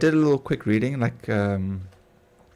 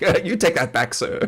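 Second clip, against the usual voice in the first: mock indignation; 7 semitones higher than usual. Acted outrage at accent remark.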